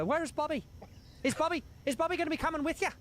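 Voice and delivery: high, quick voice for the Irish press host asking; a light take on the Irish interviewer; imitating the Irishman. Mimicking the Irish interviewer asking after Bobby, high and quick. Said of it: "the Irishman goes".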